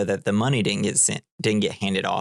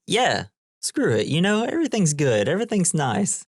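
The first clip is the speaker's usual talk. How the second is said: higher, swooping mock slacker voice; easygoing Dude; 8 semitones higher than usual. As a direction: imitate a carefree guy waving it all away. Acts out the laid-back Dude chatting.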